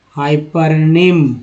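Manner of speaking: ordinary delivery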